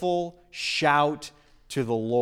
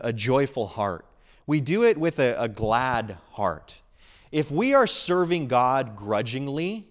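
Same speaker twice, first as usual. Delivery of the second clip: ordinary talk, nothing marked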